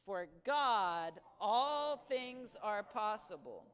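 slow, for "For God, all things are possible." to be repeated by the group; slow call and response. Shown as a demonstration. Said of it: "Can you say that with me?".

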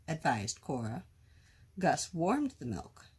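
usual speaking voice

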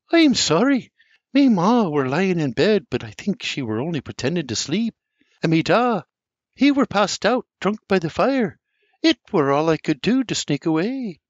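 sorry but bursting to tell it; young Anne apologising. A narrator's reading. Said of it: "turns to me Ma and says".

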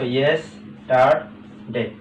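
"Yes, star, ডে" as three separate beats; teacher sounding out "yes, star, ডে" piece by piece; syllable by syllable. Shown as a demonstration. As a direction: pause after each piece of "yes, star, ডে". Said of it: "তিনটা syllable আছে".

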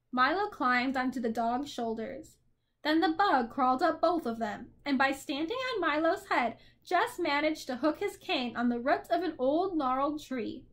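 ordinary delivery

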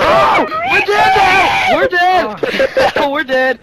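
very high drawn-out yell easing into a laugh; panicked shout, fading. Real, not acted. Player sees the team is doomed, panicked shout. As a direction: cry out in alarm, then settle into laughter.

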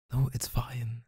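soft unvoiced whisper. Boyfriend reassuring his girlfriend she's no trouble, whispered. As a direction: breathe it out to reassure.

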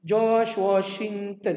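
slow, spelling out George Washington; slow dictation. Shown as a demonstration. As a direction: dictate the name slowly. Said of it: "ulang nama untuk murid". (sebutan perlahan seperti imlak)

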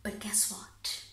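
slow, near-whispered teaser; hushed setup. Acted. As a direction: drop to a slow, confiding whisper. Confiding setup before next mishap.

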